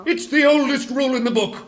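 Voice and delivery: fast, loud and high insistence; blustering. Acted. King blustering back at Alice, fast and loud.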